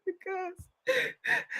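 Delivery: retold tearful outburst, breaking into a cry; crying while pleading. To Kent at the cabin, crying and half shouting. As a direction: sob it out, then cry it loud. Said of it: "And I'm crying over food".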